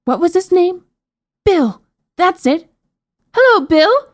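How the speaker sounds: high-pitched, excited princess; bright-pitched exclamations building to a call; loud calling out. A narrator's reading. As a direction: recall the name, then holler it. Princess calls out to giant.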